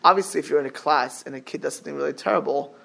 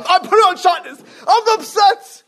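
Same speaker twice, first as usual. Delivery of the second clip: high, loud hammed-up tantrum; mock angry outburst; 13 semitones higher, 7 dB louder than usual. Lecturer mocking an angry sinner, hammed-up yell. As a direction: yell the excuse like a sulking child. Mocking someone sinning out of anger.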